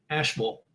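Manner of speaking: Asheville said softly as "ASH-vul"; local North Carolina pronunciation. Shown as a demonstration. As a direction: repeat Asheville quietly with a reduced "vul" ending. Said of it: "it is pronounced Asheville".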